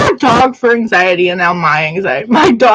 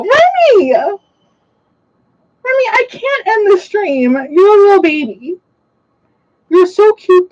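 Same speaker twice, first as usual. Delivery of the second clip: calling the dog; 8 semitones higher than usual. Real, not acted. Streamer calling her dog Remy then cooing, high baby talk. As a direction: call him out then melt into doting.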